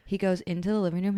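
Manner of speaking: normal talking voice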